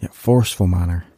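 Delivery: ordinary delivery, nothing marked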